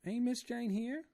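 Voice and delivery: high, loud wailing maid voice; terrified woman begging to die, high wailing; crying Esmeralda. A narrator's reading. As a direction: wail in despair with eyes shut. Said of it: "cried Esmeralda".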